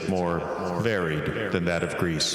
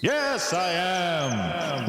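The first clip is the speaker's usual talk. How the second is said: much higher, sliding proud yes; high swoop; 9 semitones higher, much slower than usual. Acted. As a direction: lift it up in mock grandeur. Oracle answers with a high swoop.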